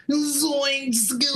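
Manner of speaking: high goofy cartoon slacker; Shaggy. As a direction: pitch it up like a scared cartoon teen. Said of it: "killer impression of Shaggy".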